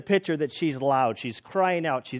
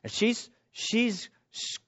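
ordinary delivery

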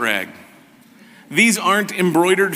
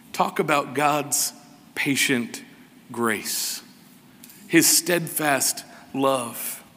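hushed reverence; 2 semitones lower, 5 dB quieter, slower than usual. Real, not acted. Preacher marvelling to congregation at God's patient grace, hushed reverence. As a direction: let each word sink in tenderly.

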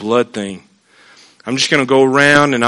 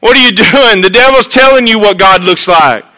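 normal talking voice

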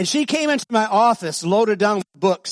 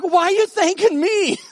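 amused surprise; 11 semitones higher, 4 dB louder than usual. Retold or imitated. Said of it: "I'm like".

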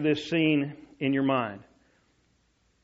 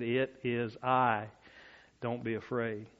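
softer, hushed, unhurried reassurance; calm quiet Jesus; 4 semitones lower, 7 dB quieter, slower than usual. Retold or imitated. Jesus calmly reassuring frightened disciples in the storm, softly. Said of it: "in a voice that I believe that they could clearly understand".

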